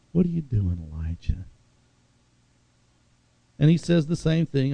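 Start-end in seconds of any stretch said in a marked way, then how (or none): 0.1-1.4 quiet and tender, fading on Elijah; softer, gentle question; loving tone. Shown as a demonstration. God softly calling Elijah out of the cave, tenderly. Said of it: "there was love in the tone".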